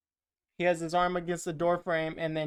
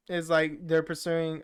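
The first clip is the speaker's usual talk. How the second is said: ordinary talk, nothing marked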